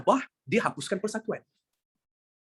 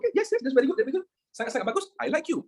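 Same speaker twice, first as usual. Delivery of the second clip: higher, bright praise for a good answer; delighted praise; 7 semitones higher, faster than usual. Real, not acted. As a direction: cheer the student on. (pujian gembira dengan nada tinggi)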